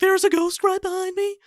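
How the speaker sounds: octave jump halfway through; Shaggy. Shown as a demonstration. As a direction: start normal, suddenly squeak up high. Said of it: "suddenly change your octave upwards".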